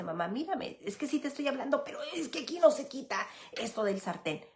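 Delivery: put-on a light kid's voice tugging at mom for attention; mock child whining "Mamá, mírame"; child's plea. Son pleading with busy mom.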